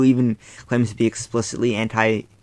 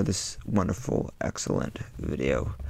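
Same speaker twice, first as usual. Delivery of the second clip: sarcastic; 4 semitones lower, 11 dB quieter than usual. Real, not acted. Sarcastic praise of troll video.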